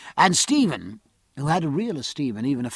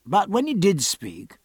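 ordinary delivery, nothing marked

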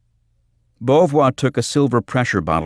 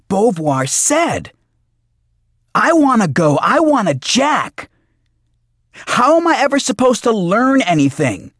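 very high, loud, whining shout; outraged protest; 6 dB louder than usual. Acted. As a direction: yell like a thwarted kid demanding his turn. Bobby protests being left out.

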